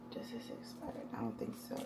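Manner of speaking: hushed, voiceless whisper while checking a label; whispering to herself. Real, not acted. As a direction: whisper it, half to yourself. Checking food, muttering to herself.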